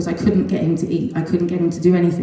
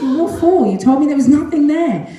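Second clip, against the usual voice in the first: retold protest, higher and louder; pushing back at the doctors; mother confronting the doctors, indignant; 9 semitones higher, 5 dB louder than usual.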